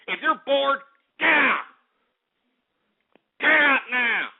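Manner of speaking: high, forceful yell; angry order. Real, not acted. Host ordering bored chatters out, angry yell.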